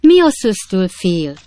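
high, loud, drawn-out question; astonished. A narrator's reading. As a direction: ask in slow amazement. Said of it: "kiáltott fel Pickwick úr csodálkozva".

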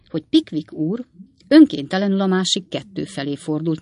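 ordinary delivery

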